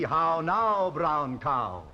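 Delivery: "How now, brown cow?" falling low and rounded; "How now" sounded out like a teacher; elocution model. Shown as a demonstration. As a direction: tutor showing the pupil the sound. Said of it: "say like me".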